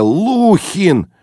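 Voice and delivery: high, stretched, falling wail; plaintive groan. A narrator's reading. Narrator pleading with his brash friend, plaintive moan. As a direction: wail the name in helpless protest. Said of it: "жалобно простонал я".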